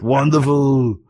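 a hint of mock Austrian accent; lightly put-on Austrian action-star voice; Arnold Schwarzenegger. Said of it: "our Schwarzenegger on the new Terminator commercial".

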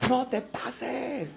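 slow, drawn-out, hushed mock awe; sarcastic God. God answering an offer of twenty percent, sarcastic. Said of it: "como que si Dios dijera como".

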